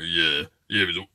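low sore-throat impression. Host imagining Karen on the day, mock sore-throat voice. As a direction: play Karen with a sore throat, pitched right down. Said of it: "might have a sore throat".

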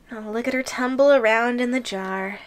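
ordinary talk, nothing marked

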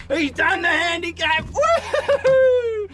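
real excited cheering; excited shouting. Real, not acted. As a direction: shout the win. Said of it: "As you can tell, I'm excited".

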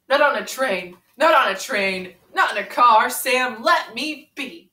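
sing-song read-aloud to a friend; storybook reading. Acted. Reading Green Eggs and Ham to Ren, storybook lilt. Reads Dr. Seuss book aloud.

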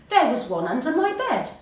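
high, loud, indignant insistence; angry. A narrator's reading. Frog insists to Hare on the ghost, angry. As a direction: insist on it crossly. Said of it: "said Frog angrily".

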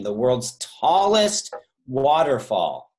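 "tallest" drawn out slow and loud; stress on tallest. Shown as a demonstration. Quiz host to the players, slow and loud on "tallest". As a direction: lean hard on "tallest" so no one mishears. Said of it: "Not widest or shortest or most volume".

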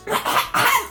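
coughing to clear the voice; throat-clearing cough. Pretend. Trying to cough the voice change out, throat-clearing cough. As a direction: hack to dislodge something in the throat. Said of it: "Może jakoś odkrztuszę".

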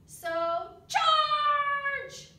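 loud high charge cry; yelled battle cry. A narrator's reading. Soldier flies yelling the attack, full shout. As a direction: bellow the charge. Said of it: "yelled the squadron".

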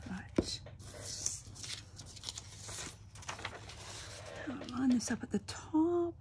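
whispered crafting notes. Real, not acted. Talking herself through the craft in a whisper. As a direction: whisper to yourself while lining it up.

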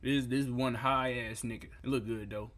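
normal talking voice

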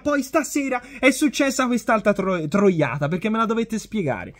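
usual speaking voice

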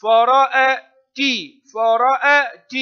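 high, loud, slow فرأت; slow model reading. Shown as a demonstration. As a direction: sound out فرأت as "fa-ra-a-ti" for pupils. Teacher models word syllable by syllable.

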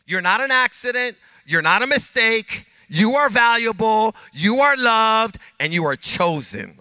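preacher's emphatic proclamation; emphatic preaching. Acted. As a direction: declare their worth with full force. Preaching affirmations.

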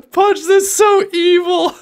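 high squealing laughter through words; cracking up while talking; laughing hard. Real, not acted. Laughing hard at Punch's drawing.

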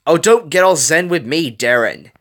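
loud, high-pitched scoff; disgusted. A narrator's reading. Gogo stops Wasabi's zen lecture, disgusted. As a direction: cut him off in disgust. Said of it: "with a disgusted tone".

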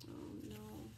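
deep quiet dismay; sad oh no. Real, not acted. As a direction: drop low in sympathy. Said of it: "reader reacts sadly".

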